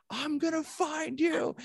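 high, drawn-out mock scream; retold scream. Pastor retelling his chase after ice throwers, mock screaming. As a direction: stretch out a high-pitched yell, held back in volume. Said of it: "and I start screaming".

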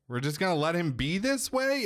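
high, disbelieving question; can't believe it; incredulous. Retold or imitated. Mimir asking Kratos in disbelief.

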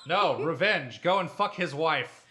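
ordinary talk

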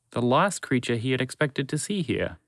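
plain reading voice

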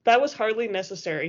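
ordinary delivery

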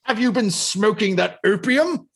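high-pitched put-on disbelief; mocking second grader. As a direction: join the mockery with an exaggerated high voice. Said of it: "they revert to, like, second graders".

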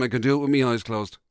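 usual speaking voice

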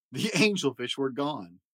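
usual speaking voice

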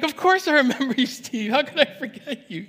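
put-on light up hearing an old friend; delighted. Pastor thrilled when Steve calls him back, delighted high voice. Delighted reply on phone call.

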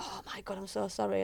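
imitated apology. Coach imitating the late player's phone apology, hushed. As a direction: drop the volume for her quick sorry.

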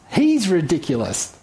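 ordinary delivery

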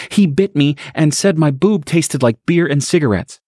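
high, urgent blurt; upset mother. Acted. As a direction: get it all out in a rush. Stacy blurts it to Harry.

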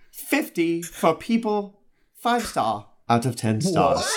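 lightly voiced street slang reader; a hint of a swaggering voice, slow; half-hearted put-on voice; reading in a put-on voice. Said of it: "a voice that goes well with mine".

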